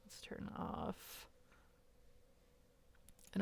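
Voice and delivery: a breathy whisper to yourself. Real, not acted. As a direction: whisper it under your breath. Muttering to herself while setting up.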